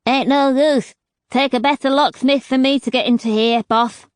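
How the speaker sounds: put-on a light lisping street accent, a bit quick; a hint of a lisping rough accent; scatter accent with lisp. A narrator's reading. Locksmith Tooth telling her boss it's no use, lightly accented and lisping. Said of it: "with a thick scatter accent and lisp".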